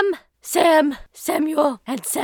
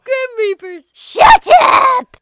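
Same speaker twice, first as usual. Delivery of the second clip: high strained yelling, much louder; strained shout; 8 semitones higher, 10 dB louder, slower than usual. Acted. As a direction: yell until your throat hurts. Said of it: "Ow, my throat".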